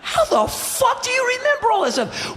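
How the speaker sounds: very high shout of disbelief; incredulous yell. Retold or imitated. Fan stunned by the singer's memory, high incredulous yell. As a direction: cry out, totally floored. Retold astonishment at total recall.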